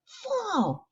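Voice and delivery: high excited shout of the answer; blurting kid calling out. Acted. Eager kid blurts out the answer to teacher, high shout. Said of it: "he shouts".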